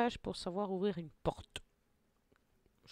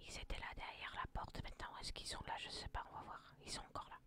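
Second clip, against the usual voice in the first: hushed whisper while sneaking; stealthy whispering; 16 dB quieter, faster than usual. Real, not acted. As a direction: whisper so the enemies won't hear. Sneaking past enemies behind door.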